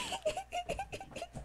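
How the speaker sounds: quiet squeaky giggle, very high; soft high giggle. Real, not acted. Streamer giggling as the villager walks away, soft high giggle.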